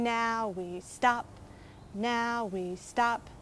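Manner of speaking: slowed-down sung "Now we stop" with rising tune; slow it right down so they freeze. Shown as a demonstration. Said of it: "When we say stop, you have to freeze".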